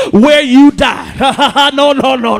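slow, drawn-out cry breaking into laughter; preacher cracking up mid-shout; laughing shout. Real, not acted. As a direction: laugh through the held words.

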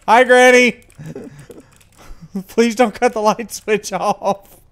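loud, high nervous plea; nervous pleading. Real, not acted. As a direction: beg her, on edge. Pleading with the ghost.

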